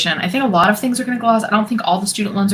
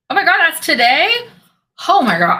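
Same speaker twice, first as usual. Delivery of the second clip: shocked realization; 9 semitones higher, 3 dB louder than usual. Real, not acted. Realizes full moon is today.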